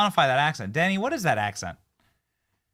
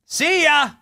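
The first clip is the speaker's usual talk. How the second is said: high, loud send-off; shouted farewell; 12 semitones higher, 10 dB louder than usual. Pretend. Host dismissing the dead news story, shouted farewell. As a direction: yell goodbye after it.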